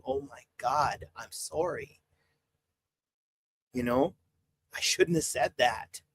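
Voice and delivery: slow, subdued mock confession; acted contrition. Livestreamer voicing a repentant sinner's confession to God, slow and contrite. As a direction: act out a hushed, slow apology to God.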